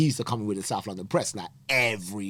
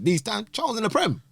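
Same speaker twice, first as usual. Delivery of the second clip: high, loud disbelief; much higher, stunned; amazed; 13 semitones higher, 5 dB louder than usual. Real, not acted. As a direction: stress how big it is. Disbelief at Premier League club.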